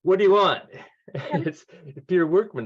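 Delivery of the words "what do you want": louder, higher curt bark of a busy boss; gruff demand. Retold or imitated. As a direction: snap it like an irritated executive. Annoyed publisher picks up phone.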